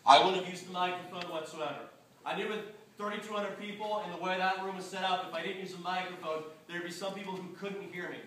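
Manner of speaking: small, distant voice away from the mic; off the microphone. Shown as a demonstration. Speaker explaining a room without a microphone, off-mic voice. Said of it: "I'm gonna move it away".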